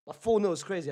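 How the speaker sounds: ordinary talk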